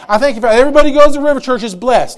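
loud, fervent thanksgiving prayer; preacher voicing a believer's bold prayer of thanks; confident prayer. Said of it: "all he does is speak what God has already told him".

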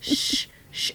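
drawn-out soothing "shh"; shushing. Retold or imitated. Mom tiptoeing backwards out of the room, shushing. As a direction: hush a baby while backing away. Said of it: "I'm, like, getting louder the further".

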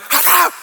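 high, loud fake sneeze; mock sneeze. Pastor mimicking an unblessed sneezer, loud mock sneeze. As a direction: sneeze big for attention. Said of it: "You're like".

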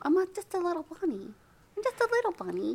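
high, fast little bunny voice; frightened prey animal. Pretend. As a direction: play a timid little bunny. Playing a scared bunny.

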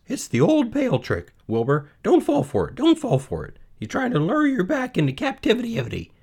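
very high, urgent goose voice; high-pitched pleading bird; warning goose. Acted. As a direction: squawk a warning as a busybody goose. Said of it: "said the goose".

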